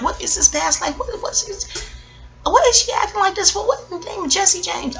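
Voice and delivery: high, strained mock-puzzled reader voice; baffled psychic reader. Reader baffled by a client's past life, high mock bewilderment. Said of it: "the reader's like".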